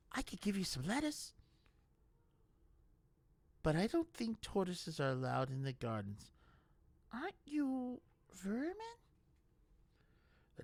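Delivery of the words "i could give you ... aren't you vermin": high, tender coaxing; gentle to animal. A narrator's reading. Brutha coaxes the tortoise, high and gentle. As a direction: talk to a small creature kindly.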